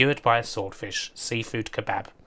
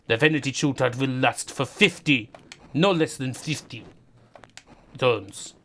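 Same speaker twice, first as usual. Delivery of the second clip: lightly Russian-accented mock Putin threat; a hint of a Russian accent, hammed-up menace; Putin impression. Mock Putin threatens Serbia with a light Russian accent. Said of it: "Putin threatening Serbia".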